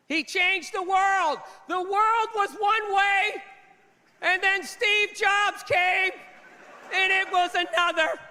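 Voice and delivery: slow, grand, solemn tribute voice; hammed-up awe like a tribute film; mock reverent eulogy. Mocking eulogies for Steve Jobs.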